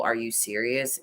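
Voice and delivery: a hint of an irritated husband; imitating annoyed disbelief. Retelling her husband's scolding, low and unimpressed. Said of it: "the double".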